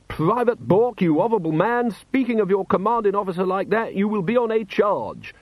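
high, loud officer's rebuke; hammed-up parade-ground telling-off; mock-stern reprimand.

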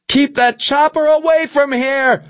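high-pitched panicked scream; frantic, high-voiced yell; Milosevic screaming. A narrator's reading. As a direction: scream it through a door, very high. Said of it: "Milosevic screamed through the door".

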